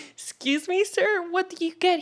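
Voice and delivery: high-pitched retold question; re-enacted polite bafflement in a high voice; acted-out puzzled reply. Acting out her puzzled reply to jogger.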